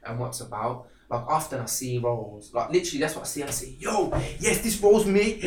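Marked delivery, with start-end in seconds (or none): 3.8-5.4 higher and louder, a thrilled yes at a perfect role; mock excited yes. Actor reading a casting call that fits him, gleeful yelp. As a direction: pounce on the casting call like it's yours.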